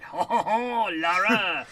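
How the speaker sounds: put-on hammy outrageous French accent. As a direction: ham up a French villain, ho ho. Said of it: "that's actually how he sounds".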